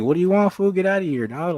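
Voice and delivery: retelling how he told the cop to beat it; retold brush-off. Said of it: "kept telling him, like".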